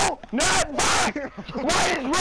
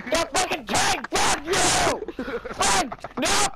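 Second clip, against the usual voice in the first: ordinary talk, nothing marked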